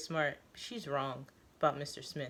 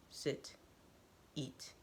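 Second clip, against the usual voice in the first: quiet command; 13 dB quieter than usual. Acted. Teo pulls narrator back down.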